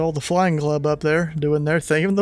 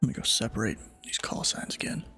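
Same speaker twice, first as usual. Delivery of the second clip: whispering; 5 semitones lower, 9 dB quieter than usual. Real, not acted. Muttered aside off mic.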